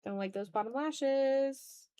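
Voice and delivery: ordinary talk, nothing marked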